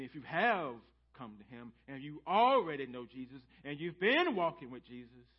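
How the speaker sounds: normal talking voice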